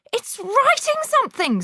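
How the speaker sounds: put-on very high shrill child's scream; hysterical scream. A narrator's reading. Pupil screaming at the moving chalk, shrill. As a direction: shriek in terror, pointing. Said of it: "screamed Nigel".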